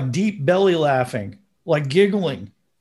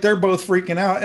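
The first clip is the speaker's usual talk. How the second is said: ordinary delivery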